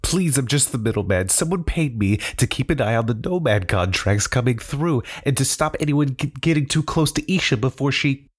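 begging for my life. Acted. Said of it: "begged for his life".

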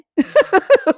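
loud burst of laughter; laughing. Real, not acted. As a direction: laugh at own joke. Laughs at not being [name].

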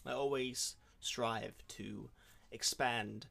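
put-on American accent, lightly done; podcast host faking an American accent; fake American accent. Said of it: "That sounds so American".

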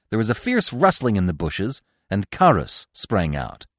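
usual speaking voice

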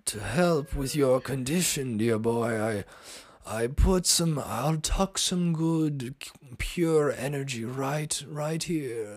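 high, slow mock English accent; hammed-up channeled Englishman, dragging the words; Doctor James's English accent. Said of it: "she was like".

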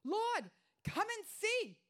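pleading cry. Acted. Quoting Martha, preaching climax.

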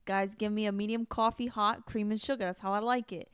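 chatty Long Island deli talk; a hint of Long Island accent. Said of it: "Long Island voice".

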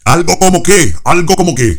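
very high, loud shout. Acted. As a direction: bellow the question. Shouting gag continues.

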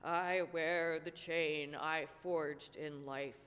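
slow, low, heavy phantom; ghost voice. Acted. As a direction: intone it like a doomed ghost. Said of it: "replied the ghost".

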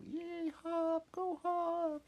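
very high cheering voices; remembered cheer. Retold or imitated. Hop recalling the crowd, very high cheer.

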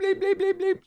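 high squeaky censor bleeps; quack censor. Pretend.

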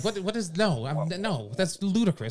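ordinary talk

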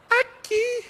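put-on very high little boy's whimper; high childish reply; small child voice. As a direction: answer like a hurt toddler. Child's answer.

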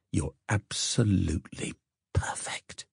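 slow, adoring whisper; soft whisper. Acted. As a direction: whisper it, utterly besotted. Besotted man murmurs compliment.

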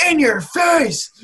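high, loud, slow triumphant yell; intense shout. Retold or imitated. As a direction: bellow it like a volleyball player who just spiked. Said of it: "someone else said it so intensely".

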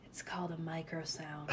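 soft, low DJ murmur; hushed DJ voice. Shown as a demonstration. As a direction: murmur it into the mic. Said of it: "Nobody can hear that".

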